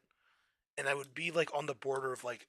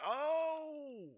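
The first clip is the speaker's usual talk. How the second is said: high, drawn-out realizing oh; dawning oh; 12 semitones higher, 4 dB quieter than usual. Retold or imitated. Imitating himself finally getting the slang, high and drawn out. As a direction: let the penny drop slowly. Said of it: "I'm like".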